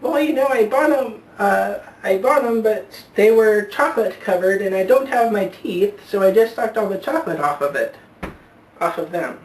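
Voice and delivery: put-on high toothless old lady voice; old woman voice.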